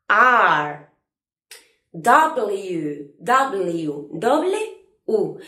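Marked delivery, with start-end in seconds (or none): none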